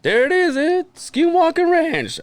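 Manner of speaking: high, slow, thrilled announcing; excited high call. Retold or imitated. Friend filming from his car, announcing the ranch excitedly. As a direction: stretch it out like pointing at a landmark. Quinn pointing out Skinwalker Ranch.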